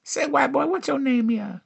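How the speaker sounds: high, falling singsong question; imitated flirting woman. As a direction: pitch it way up, then slide down. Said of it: "I had her tell me, like, uh".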